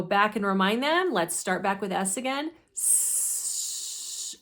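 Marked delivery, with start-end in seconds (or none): 2.7-4.4 "S" hissed through rounded lips; windy sound. Shown as a demonstration. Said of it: "just round your lips for that".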